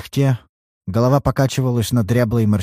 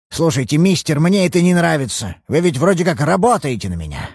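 put-on higher, harder old man's voice; hostile old father; 4 semitones higher than usual. Old father throwing the detective out of his house. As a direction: turn cold and dismissive.

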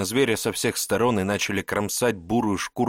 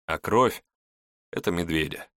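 usual speaking voice

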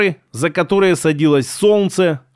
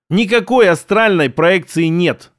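plain reading voice

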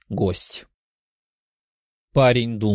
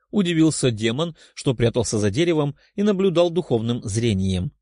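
ordinary delivery, nothing marked

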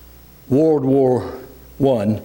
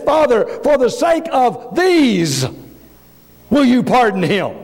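preached plea; 9 semitones higher, slower than usual. Acted. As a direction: cry it out as the climax. Jesus pleads, preached climax.

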